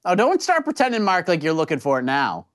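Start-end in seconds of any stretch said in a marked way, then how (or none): none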